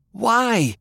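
very high, anguished question; distraught. A narrator's reading. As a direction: plead for a reason, pitch leaping up. Francis distraught over the curse.

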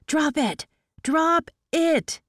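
high-pitched urgent command; contained narrator's shout, pitched well up; shouted order. A narrator's reading. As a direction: shout it as an order, second one drawn out. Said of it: "Hannah shouted".

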